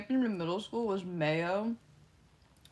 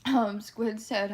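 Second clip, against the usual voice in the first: normal talking voice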